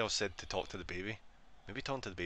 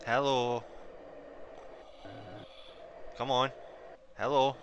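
calling out; 4 semitones higher, slower than usual. Real, not acted. Calling to game ghost by voice.

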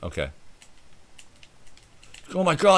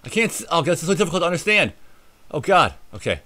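ordinary delivery

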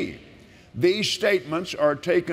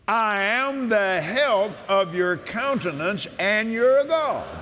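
preaching at full force; 5 semitones higher than usual. Acted. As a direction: shout the promise like from the pulpit.

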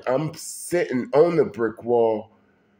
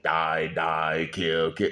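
low, soft, menacing voice from the dark; sinister demon voice; 9 semitones lower, 5 dB quieter, slower than usual. Retold or imitated. Narrator recalls the demon voice ordering him to kill, low and menacing. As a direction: chant it like an evil spirit in his head. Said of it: "this voice, that voice that was like".

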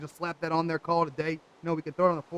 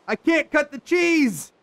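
high, loud, drawn-out wail of complaint; frustrated shout; 8 semitones higher, 7 dB louder, much slower than usual. Real, not acted. Player blocked by a game glitch, frustrated shout. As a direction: cry it out slowly and loudly.

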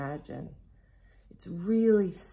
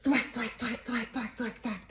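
high, rapid-fire thwacks; rhythmic sound effect; 6 semitones higher than usual. A narrator's reading. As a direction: imitate an animal shaking itself dry. Imitates shaking dry in the story.

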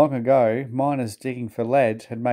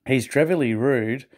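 twittering. A narrator's reading. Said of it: "they twittered to each other".

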